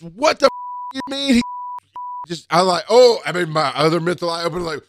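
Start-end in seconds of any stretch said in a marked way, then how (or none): 0.0-2.5 angry outburst. Retold or imitated. Patient's kids jump off couch. 2.9-3.2 startled oh. Retold or imitated. Caught off guard by angry kids.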